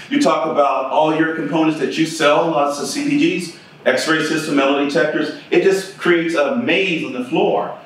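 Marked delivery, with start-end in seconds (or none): none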